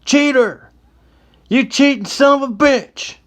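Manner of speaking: high, loud accusing yell; furious yell. Acted. Card player yelling at the cheat across the bar, furious high shout. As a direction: bellow it across the saloon at the cheater. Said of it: "was yelling to the other man".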